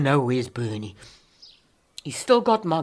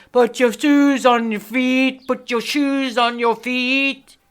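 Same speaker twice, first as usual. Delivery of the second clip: shout; 9 semitones higher, 8 dB louder than usual. Acted. Woman shouts back at people nagging about her shoes. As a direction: yell it back at them.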